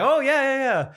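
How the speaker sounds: high, eager yeahs; suddenly remember the voice; high, eager yeahs on recognizing a friend; excited recognition. Retold or imitated. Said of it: "Isaac's like".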